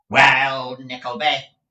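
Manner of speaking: loud, menacing opening; malicious. Acted. Squeers turning on Nicholas with malice, loud. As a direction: lean on it with spite. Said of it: "eyeing him maliciously".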